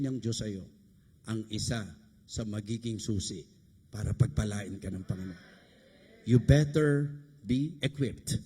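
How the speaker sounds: low, measured preaching voice; solemn exhortation. Real, not acted. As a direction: drop low and charge them solemnly. (mababa at taimtim na pangaral)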